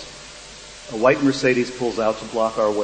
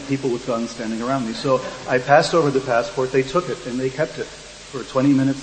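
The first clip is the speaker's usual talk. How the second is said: normal talking voice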